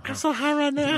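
high squeaky stoner voice. Pretend. Voicing the high gerbil.

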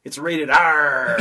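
loud, drawn-out pirate punchline. Acted. Comedian delivers a pirate movie punchline, pirate voice. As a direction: stretch the R into a pirate's arr. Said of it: "that new pirate movie".

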